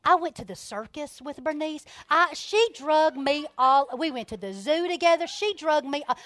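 normal talking voice

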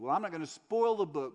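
usual speaking voice

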